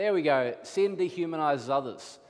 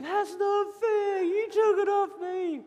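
hammed-up screaming little boy, very high; mock tantrum scream in a squeal; Archer; 3 dB louder than usual. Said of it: "Archer's screaming".

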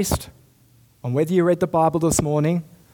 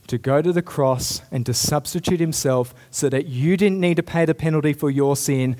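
normal talking voice

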